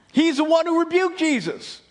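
ordinary talk

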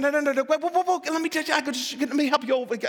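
high, tumbling attempts to break in; frantic interjection. God trying to stop the busy person, frantically interjecting. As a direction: plead for a moment, words spilling out.

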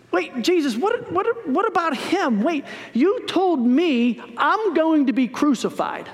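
indignant questioning, stumbling at the start; Peter. Acted. Disciple objects to his teacher about John, flustered protest.

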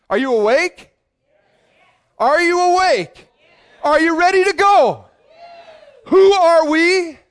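high, loud call to the crowd; big preacher's call, pulling the crowd in; rousing shout. Real, not acted. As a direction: wake them up, shout it out.

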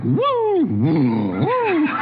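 high swooping whoops; excited whoops. Shown as a demonstration. Pastor showing he's not sunny and mild, wild whoops. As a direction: whoop up and down wildly. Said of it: "I'm like".

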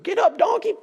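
high yelled command at the donkey; angry rider shouting. Retold or imitated. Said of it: "He's like".

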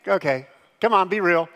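usual speaking voice

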